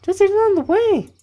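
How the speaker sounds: loud falsetto camp leader hurrying someone along; badly faked, very high female voice; bad girl voice. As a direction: urge them on in squeaky falsetto. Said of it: "That was a really bad girl voice".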